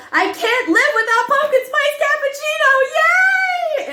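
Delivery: very high, loud, over-the-top ad read. Retold or imitated. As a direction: shriek the line with goofball enthusiasm. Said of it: "say it again with more energy".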